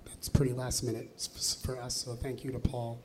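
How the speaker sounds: hoarse, strained voice from laryngitis. Real, not acted. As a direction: speak through a voice that's nearly gone.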